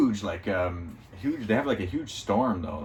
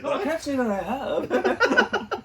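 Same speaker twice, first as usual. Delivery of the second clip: laughing quote; slower than usual. Retold or imitated. Laughing redo of Ron's line.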